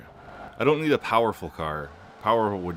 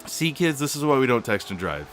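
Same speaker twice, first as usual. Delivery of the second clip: hammed-up preachy PSA, pitched up; mock lecture to kids; 6 semitones higher, 5 dB louder than usual. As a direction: play a mock safety-ad lecturer. Mock safety lesson to kids.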